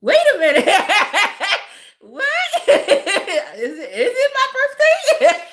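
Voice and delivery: high mock confusion breaking into laughter; laughing through a baffled, high-pitched question; confused, laughing. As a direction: act totally puzzled, cracking up between words. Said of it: "I was so confused".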